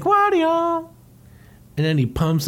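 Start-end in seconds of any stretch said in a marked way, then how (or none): 0.0-0.9 high cartoon call; Mario calling. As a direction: call his name up high like a cartoon hero. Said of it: "And he's like".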